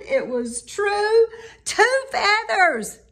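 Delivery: delighted. A narrator's reading. Gertrude cheers at her feathers.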